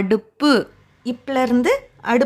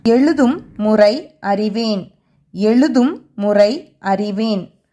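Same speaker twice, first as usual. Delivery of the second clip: slow announced heading; 3 semitones lower, much slower than usual. Shown as a demonstration. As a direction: chant the title slowly. Lesson section heading.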